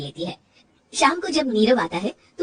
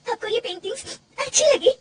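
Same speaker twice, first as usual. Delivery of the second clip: much higher, teary hopeful question; tearful disbelief; 12 semitones higher than usual. Acted. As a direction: ask in tears if they really like her work. Surprised, still crying. (आँसुओं में हैरानी भरा सवाल)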